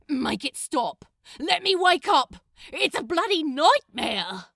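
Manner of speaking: loud, high, frantic yelling; Crowley yelling at Gabriel in panic; desperate shout. Acted. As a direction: scream to escape the nightmare.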